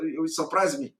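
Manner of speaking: ordinary talk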